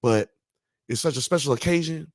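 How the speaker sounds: high, excited imitation of a pumped-up friend; mock-excited athlete offering a celebration drink; imitating Ocho Cinco.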